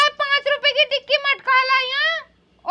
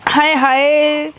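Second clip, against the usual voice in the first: low, slow, drawn-out longing for sweets; dreamy craving; 8 semitones lower, much slower than usual. Acted. Mistress dreaming of sweets, slow and longing. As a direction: savor each sweet's name slowly.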